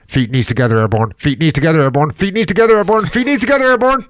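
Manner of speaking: megaphone instructor, pitch climbing with each repeat; escalating mock megaphone call; imitated megaphone. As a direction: mimic a loudspeaker warning, rising to frantic high pitch. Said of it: "the guy's on the megaphone".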